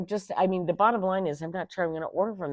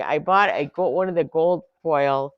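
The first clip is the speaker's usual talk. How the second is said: ordinary talk, nothing marked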